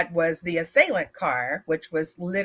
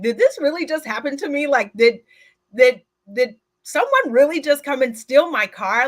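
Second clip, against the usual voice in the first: stunned disbelief; 6 semitones higher, 7 dB louder than usual. Retold or imitated. Disbelief right after the theft.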